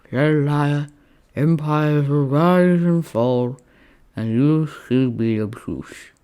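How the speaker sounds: slow, loud, grandiose slur; feverish Roman, declaiming. Acted. Sick Roman to cowboy friend, grandly exasperated. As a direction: declaim slowly with weary grandeur.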